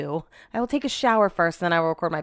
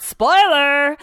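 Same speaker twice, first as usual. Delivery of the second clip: higher, much louder call-out; loud exclamation; 5 semitones higher, 7 dB louder than usual. Real, not acted. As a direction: announce it with a shout. Exclamation to listeners.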